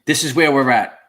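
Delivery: usual speaking voice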